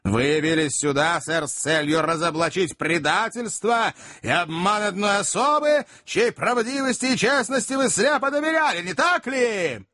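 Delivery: jealous tirade climbing high in pitch; indignant, mounting denunciation; accusing Magnus. A narrator's reading. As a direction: build the accusation to a high peak.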